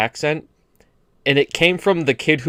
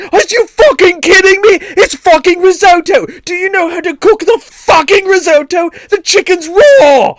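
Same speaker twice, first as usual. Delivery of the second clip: loud, much higher furious British chef yell; Gordon Ramsay rant; 9 dB louder than usual. Retold or imitated. Comparing baking show to a furious chef, high loud British yell. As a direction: scream at the cook like a raging chef. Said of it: "Gordon Ramsay going".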